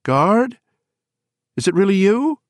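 cautious, high and shrill question; Bobby. A narrator's reading. Woman checking it is really her friend, cautious and shrill. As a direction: call out high and loud, warily hopeful.

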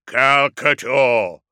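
slow, thunderous dreadnought call; booming. A narrator's reading. Dreadnought thunders at his hidden enemy, booming. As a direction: boom the name out, drawn long. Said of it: "boomed the dreadnought".

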